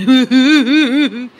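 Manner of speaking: loud helpless laugh, close to tears; laughing till crying. Real, not acted. Vlogger mocks aunt's power cuts, helpless loud laughter. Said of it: "मी हसते का रडते मला समजत नाही".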